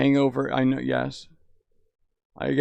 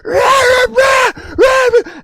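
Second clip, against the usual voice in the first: high, loud nonsense yelling; hammed-up parental yelling with no words; mock ranting yell; 10 dB louder, much slower than usual. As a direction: bark it like screaming parents. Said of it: "they would be like".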